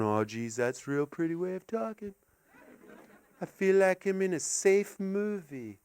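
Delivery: soft, hushed wonder; mesmerized. Retold or imitated. Comic listening to a lovely English accent, softly mesmerized. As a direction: murmur it, lulled by their accent. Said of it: "I'm always mesmerized going".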